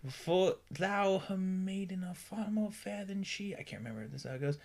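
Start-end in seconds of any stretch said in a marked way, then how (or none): 0.1-3.5 high, slow theatrical recital; dramatic Shakespeare voice. Shown as a demonstration. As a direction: declaim it like a hammy Romeo. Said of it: "I didn't say it in this voice".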